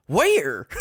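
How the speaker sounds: incredulous. Retold or imitated. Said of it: "I audibly was like".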